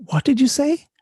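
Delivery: sudden high, loud disbelief; startled. Retold or imitated. Attacker hearing an unexpected confession, high and startled. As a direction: jump up in pitch, caught off guard.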